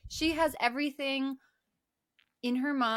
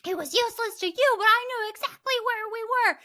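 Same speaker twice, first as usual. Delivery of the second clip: high, louder, fast retort; mock Heather retort; 12 semitones higher, 5 dB louder than usual. Heather retorting to Mike, high and fast. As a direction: snap back defensively. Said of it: "she's like".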